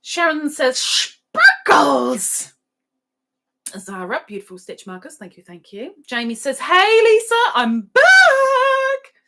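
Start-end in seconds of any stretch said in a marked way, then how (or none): 0.8-2.5 loud, high exclamation; playful high shout of a chat message; mock excited shout. Acting out a viewer's exclamation. 6.6-9.1 very high, loud holler; playful high-pitched yelled greeting; mock announced return. Acting out a returning viewer's message.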